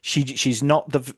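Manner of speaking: usual speaking voice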